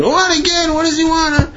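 very high, strained squawk of disbelief; mock outraged questioning; exasperated outburst. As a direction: explode at yet another interruption. Retold Ziskin exasperated by repeat visit.

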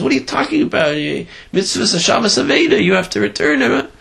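high, indignant protest; indignant wife. Retold or imitated. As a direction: demand he give it back, pitched high. Wife protests finder keeping wallet.

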